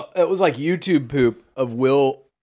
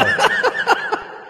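high laugh. Real, not acted. Laugh after bit.

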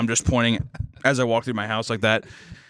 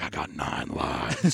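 much lower, very slow and soft menace; creepy villain voice; 11 semitones lower, 5 dB quieter, much slower than usual. Pretend. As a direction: growl it like an unkillable horror villain. Imagined creepy neighbor.

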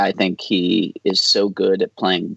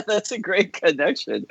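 laughing; 13 semitones higher than usual. Real, not acted. Amused reaction to chat question.

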